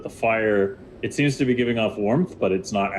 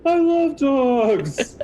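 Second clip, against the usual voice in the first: very high, loud, drawn-out squeal; gushing excitement; 5 dB louder, much slower than usual. Acted. Demonkin woman snuggling the dog, loud drawn-out squeal. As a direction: stretch it out in pure delight. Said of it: "She's like".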